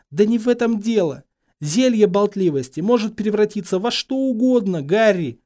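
high, loud, urgent; agitated, emphatic explanation; exasperated warning. A narrator's reading. Hermione urgently warns Harry.

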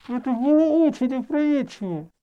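high, muffled voice; smothered, high-pitched words; muffled by cloth. A narrator's reading. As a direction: speak into balled-up fabric. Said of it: "скомкала её и прижала к лицу".

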